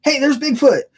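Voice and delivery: high-pitched imitation of a sudden yell; retold yell. Trainer yelling to the driver out of the blue, high mock yell. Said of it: "this trainer just yells out".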